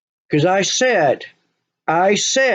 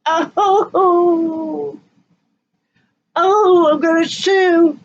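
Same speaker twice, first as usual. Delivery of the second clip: high wailing mock sob of a grieving parent; mock crying parent; 8 semitones higher, much slower than usual. Mocking a mosh pit mother, high fake wailing. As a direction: ham up a parent crying to sue. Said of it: "You sit there".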